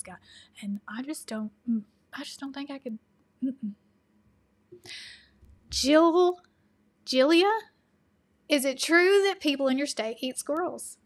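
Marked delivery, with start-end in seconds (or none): 5.7-11.0 loud, higher mock questioning; calling out. Vlogger putting a viewer's question to herself, calling out. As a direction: call the name out loud, then ask.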